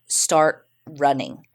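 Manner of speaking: "Start running" drawn out slowly, each word spaced; slow, insistent repeat. Retold or imitated. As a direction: repeat the order slowly and firmly, like they mean it. Kidnappers repeat the order.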